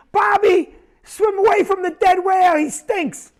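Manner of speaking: put-on high, loud shout calling a kid out of the water; frantic parent shout. Parent on the beach shouting to Bobby, loud panicked call. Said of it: "It'll be".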